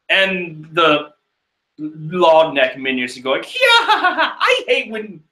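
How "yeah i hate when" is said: mocking laugh. Minions mocking the hero.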